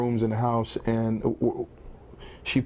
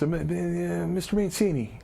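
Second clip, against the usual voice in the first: lightly voiced halting caller, pitched up; Chief; 5 semitones higher, 3 dB quieter than usual. Old boss phones his former wrestler, hesitant impression. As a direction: imitate a hesitant phone caller stumbling into the name. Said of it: "So I got the obscure phone calls".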